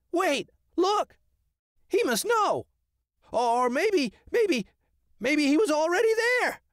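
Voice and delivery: very high excited squawk; squawking crow. Acted. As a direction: caw it out in excitement. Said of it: "he squawked".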